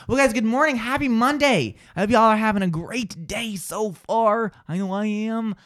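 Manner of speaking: high, bright vlogger greeting; vlog intro. Acted. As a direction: greet the viewers with energy.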